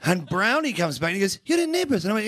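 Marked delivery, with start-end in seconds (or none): 1.5-2.0 very high, incredulous question; comic starstruck disbelief; acted amazement. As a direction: squeal it as an astonished gang leader. Gang leader reacts amazed to actor.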